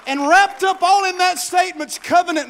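loud, rising preacher's build; full-force preaching. Acted. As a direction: preach it out to the whole church.

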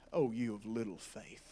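very soft, low voice; gentle reproach. Acted. Jesus to worried followers, gently chiding. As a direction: drop right down, almost under the breath.

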